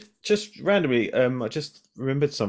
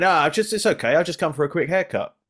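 mock American accent; 6 semitones higher than usual. Barber retelling the caller, a hint of an American accent. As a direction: lightly put on an American accent, pushy. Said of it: "with an American accent".

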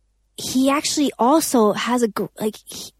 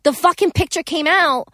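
indignant rant; 6 semitones higher than usual. Real, not acted. Indignant paparazzi story.

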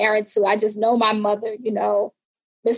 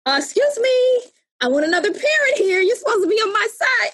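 high, fast whiny protest; mock indignation; 9 semitones higher than usual. Teen daughter protesting to her mother, high whiny indignation. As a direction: plead like a betrayed kid. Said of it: "I was looking at her like".

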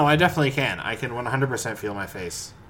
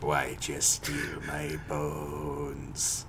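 spooky voice; 9 semitones lower, 8 dB quieter, much slower than usual. Acted. Spooky intro bit, repeated line.

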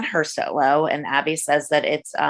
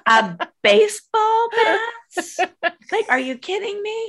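shocked disbelief. Retold or imitated. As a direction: gasp it out in total disbelief. Shocked reaction to bat.

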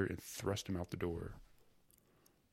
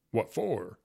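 husky; 3 semitones higher, 6 dB louder than usual. A narrator's reading. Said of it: "Fyodor asked in a husky voice".

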